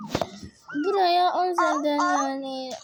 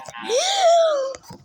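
very high, loud shocked gasp with a laugh; shrieking gasp; 13 semitones higher, 8 dB louder than usual. Real, not acted. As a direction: gasp in real fright, half laughing. Shocked by scary sight.

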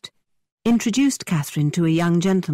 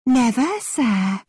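hammy stage actor; 7 semitones higher, much slower than usual. A narrator's reading. Playing along in the game.

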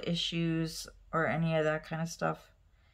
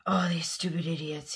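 whispered grumble. Retold or imitated. Wife retelling husband's text about the customers, whispered grumble. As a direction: hiss it under your breath, fed up.

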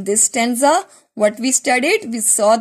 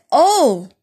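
surprised cry; 4 semitones higher, 4 dB louder than usual. Acted. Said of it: "She said".

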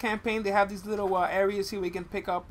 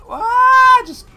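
startled shout; 17 dB louder, slower than usual. Real, not acted. Near explosion in game.

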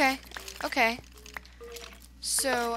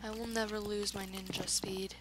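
whisper; 3 semitones lower, 8 dB quieter than usual. Pretend. Whispered boast.

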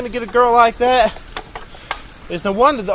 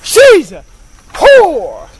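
high, loud, repeated shout; shouted insult; 8 semitones higher, 11 dB louder, much slower than usual. Shown as a demonstration. Man in car shouting the same insult over and over. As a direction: bellow it, testing the tone each time. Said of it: "I'm trying to say it with the right perfect tone".

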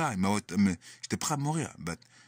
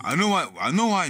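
raised, anguished voice; louder, higher; 8 semitones higher, 5 dB louder than usual. Real, not acted. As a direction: voice rising in distress. Emotional outburst about the arrest.

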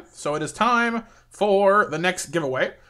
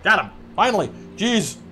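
usual speaking voice

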